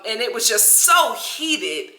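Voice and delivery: ordinary talk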